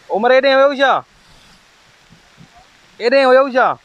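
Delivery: loud, high call across distance. Real, not acted. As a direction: shout to someone far away. Calling out to brother far off.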